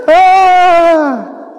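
high, hammed-up frightened cry; storyteller's pretend scream of fright; mock scream. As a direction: yelp like a scared sailor.

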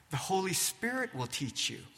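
usual speaking voice

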